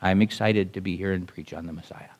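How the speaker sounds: low, soft, lifeless delivery; unexcited. Shown as a demonstration. Preacher showing how not to preach the message, unexcited. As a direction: claim excitement with none in the voice, low and quiet. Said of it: "you can't just say ... like this".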